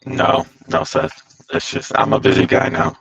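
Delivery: glitchy robot-like audio. Real, not acted. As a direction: sound like a robotic voice. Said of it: "Dude, you sound like a robot".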